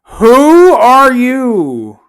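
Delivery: high, very slow, drawn-out mock bafflement; mock baffled. To a stranger's friend request, a high, slow, baffled who are you.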